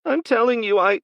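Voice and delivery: put-on a terrified skinny kid blurting out a plea; boy with a pistol in his mouth pleading, panicked.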